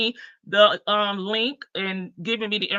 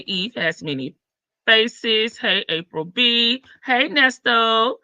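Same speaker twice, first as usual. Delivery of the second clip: ordinary talk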